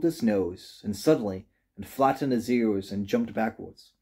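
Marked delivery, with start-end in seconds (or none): none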